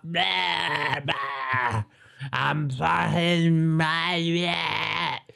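put-on high, slurred drawn-out drunken wail; drunk TikTok guy. Steaming old man filming himself in torrential rain, drunken wailing. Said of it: "he was just filming a TikTok like that".

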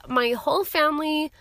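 ordinary delivery

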